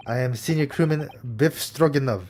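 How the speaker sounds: put-on lightly Russian-accented gruff crewman; mild Russian accent, stiff and proud; Russian sailor.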